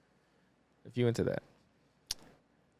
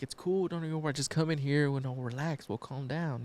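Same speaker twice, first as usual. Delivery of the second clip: soft, lightly pitched-up reassurance; soothing; 3 semitones higher, 5 dB quieter than usual. Acted. Husband tells worried woman to relax, soothing. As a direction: talk them down softly.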